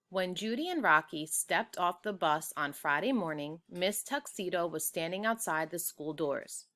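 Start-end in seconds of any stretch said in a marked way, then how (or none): none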